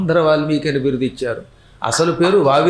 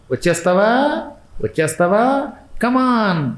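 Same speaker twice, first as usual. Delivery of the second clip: coaxing calls, pitched up, rising; lightly played beckoning from death's servants; mock yama messengers; 5 semitones higher, slower than usual. Messengers beckon the dying man in many languages, mock coaxing. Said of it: "రకరకాల లాంగ్వేజెస్లో పలుకుతారు".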